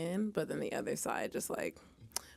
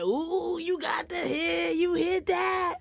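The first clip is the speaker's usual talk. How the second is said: high, slow, loud hype shout; excited cheering; 12 semitones higher, 6 dB louder, much slower than usual. Retold or imitated. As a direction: cheer them on like a hype friend. Retold friends cheering, high shout.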